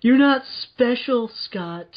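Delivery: high, slow scolding villainess; drawn-out, high-pitched put-down; Granny. Retold or imitated. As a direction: belittle him slowly, pitched well above your own voice. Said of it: "when Granny's sitting there".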